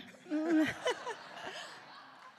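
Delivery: a real, high, embarrassed giggle; high laugh. Real, not acted. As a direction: laugh shyly as you come up. Wife laughs coming up.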